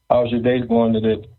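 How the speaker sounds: ordinary talk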